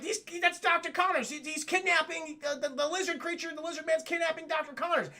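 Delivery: high, stammering panic, acted; playacted flustered alarm; mock alarm. Peter reacts to apparent kidnapping.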